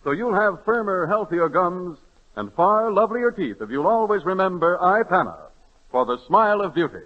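ordinary delivery

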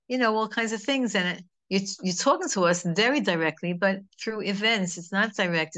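ordinary talk, nothing marked

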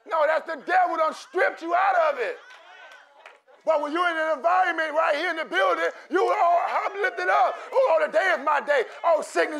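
loud, fervent preacher cadence with acted worshipper cries; full-force preaching. Pastor stirring the congregation to believe, preaching at full force. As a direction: preach it with rising fire.

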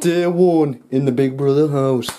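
slow, drawn-out Irish announcer; Irish accent. Shown as a demonstration. Friends ask him to do it in his accent. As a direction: say it in your own Irish accent. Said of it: "[name], go on. You do it again".

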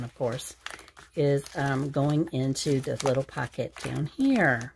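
ordinary talk, nothing marked

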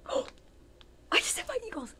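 hammed-up horror at her own slip; mock shock. Catches herself saying rival team.

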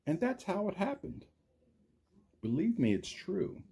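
lively storybook read, big rises and falls; reading to children. A narrator's reading. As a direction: read it like story time for little kids. Story's closing lines read aloud.

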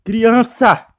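cri fort et exaspéré; crie. Real, not acted. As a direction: chasse le bébé en criant. Crie sur le bébé.

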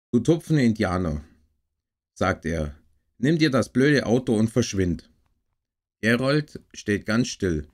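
clearly projected read-aloud storytelling with lively dialogue; story narration. Acted. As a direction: read a children's story aloud in measured phrases with pauses. Liest eine Weihnachtsgeschichte vor. (lebhaft vorgelesene Kindergeschichte)

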